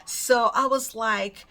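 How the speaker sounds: usual speaking voice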